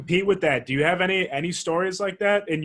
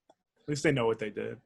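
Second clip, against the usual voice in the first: unvoiced amused aside; whispered aside; 5 semitones lower, 5 dB quieter than usual. Real, not acted. Joking about the maid, whispered aside. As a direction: murmur the joke under your breath. Quiet joke after laugh.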